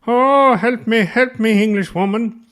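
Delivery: high, loud, hammed-up pathetic pleading; helpless Irishman. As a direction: ham it up like a clueless caller begging for help.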